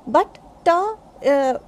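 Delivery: ordinary talk, nothing marked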